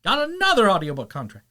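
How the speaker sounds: high, loud hammed-up boast; mock announcer. Boasting he narrates for Audible, high loud announcer. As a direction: brag like a game pop-up. Bragging in game voice.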